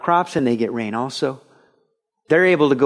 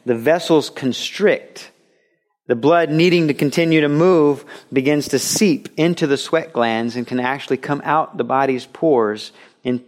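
usual speaking voice

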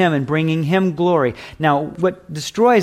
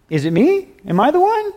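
"Is it me? Am I the one?" with the final "one" jumping far higher in worried doubt; anxious question; 14 semitones higher, 4 dB louder than usual. Shown as a demonstration. A disciple fearfully asking Jesus at supper, anxious rising question. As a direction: ask it fearfully, voice climbing on "Am I the one?". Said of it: "the question is not said in an".

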